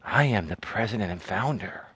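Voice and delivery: soft, low ASMR voice, close to the mic. Shown as a demonstration. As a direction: murmur it low and hushed like an ASMR channel intro. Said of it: "A S M R tone is like".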